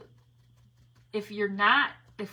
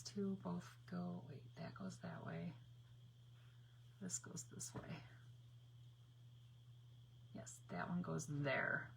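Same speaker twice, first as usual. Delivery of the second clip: whisper; 2 semitones lower, 17 dB quieter than usual. Real, not acted. Sorting wings quietly.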